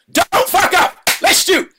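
high, loud shouted order; mock director yell. Director's final order before the take, loud high yell. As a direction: blast the last instruction at him. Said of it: "And he's like".

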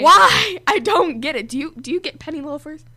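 loud, high baffled why. Retold or imitated. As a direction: exclaim in disbelief. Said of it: "And I'm like".

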